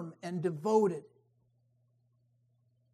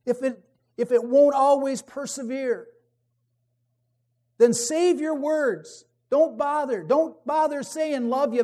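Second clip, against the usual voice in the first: higher, louder fervent preaching; preaching at full force; 4 semitones higher, 5 dB louder than usual. Acted. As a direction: drive it home to the congregation.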